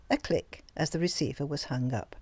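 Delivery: usual speaking voice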